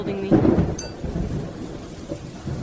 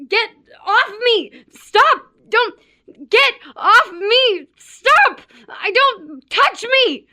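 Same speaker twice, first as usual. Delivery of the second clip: high, loud cries in broken bursts; panicked protest; 8 semitones higher, 4 dB louder, slower than usual. Acted. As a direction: fight someone off with each phrase. Fighting off an attacker.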